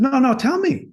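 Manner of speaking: excited plea; eager insistence. Retold or imitated. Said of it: "I said".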